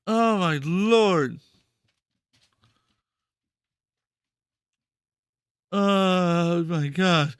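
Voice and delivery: slow drawn-out oh my god; stunned slow groan. Real, not acted. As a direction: let it out slowly, dumbfounded. Stunned disbelief.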